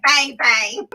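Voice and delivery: normal talking voice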